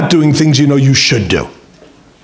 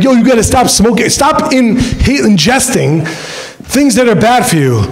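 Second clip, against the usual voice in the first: put-on lecturing the kid; 6 semitones higher than usual. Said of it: "you go to the kid, like".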